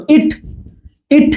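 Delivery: ইট cut short on the vowel; quick, brief ই in ইট. Shown as a demonstration. Teacher giving a clearer example, very short ই in ইট. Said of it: "অল্প সময়ের জন্য উচ্চারণ হচ্ছে".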